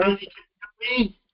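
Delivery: choppy phone line; phone breaking up. Real, not acted. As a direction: voice cutting in and out on a bad line. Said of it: "It sounds like you're breaking up".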